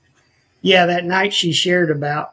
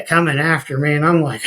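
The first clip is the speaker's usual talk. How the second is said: ordinary delivery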